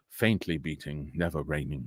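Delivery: low, hushed storytelling voice; hushed recital. Acted. Recalling Poe's Telltale Heart, hushed and low. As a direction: drop low and soft, like a spooky tale.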